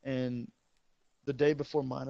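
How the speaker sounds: ordinary delivery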